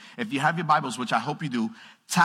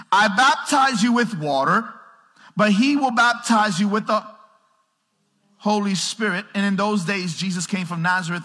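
full-force preaching; 8 semitones higher, 8 dB louder than usual. Acted. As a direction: proclaim it to the whole room.

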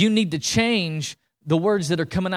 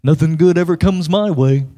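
loud, droning woe-is-me voice; Eeyore; 9 dB louder than usual. Acted. Preacher playing the most negative guy, loud gloomy moan. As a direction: moan it out like a hopeless pessimist. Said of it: "Eeyore's like".

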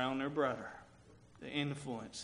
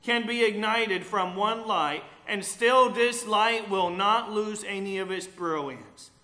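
loud, higher sermon voice, building with fervour; preaching at full force; 7 semitones higher, 15 dB louder, slower than usual. Acted. Pastor preaching grace to the congregation, loud and fervent. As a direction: proclaim it to the whole church.

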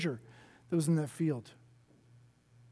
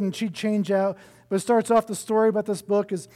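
normal talking voice

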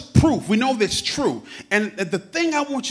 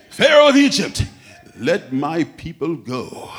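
mock British accent; 4 semitones lower, 5 dB louder than usual. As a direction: proclaim it in a hammed-up British accent. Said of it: "had a British accent".

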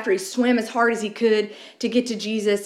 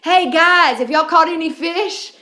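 raised, higher call across the water; calling out from shore; 5 semitones higher than usual. Retold or imitated. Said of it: "he yells out to Peter".

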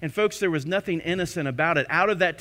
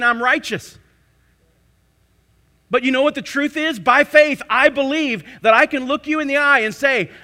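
loud, high, declaring sermon voice; preaching at full force; 7 semitones higher, 7 dB louder than usual. Acted. Preacher proclaiming to the congregation that believers are righteous, loud and high.

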